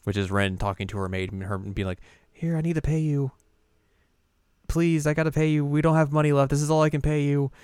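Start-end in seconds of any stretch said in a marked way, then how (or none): 2.3-7.5 high, earnest pleading; a hint of a distressed heiress; pleading Ren. Ren pleading with her maid.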